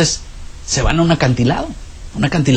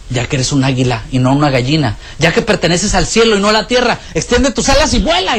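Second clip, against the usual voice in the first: rising command. Acted. Naturalist holding the eagle on the roof: building, rising command.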